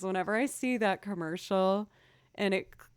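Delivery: ordinary talk, nothing marked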